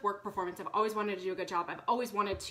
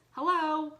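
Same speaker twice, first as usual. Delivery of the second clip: high, drawn-out ironic hello, as if stating the obvious; a pointed "come on" hello; mock obvious hello; 8 semitones higher, 5 dB louder than usual. Ironic aside about Jesus.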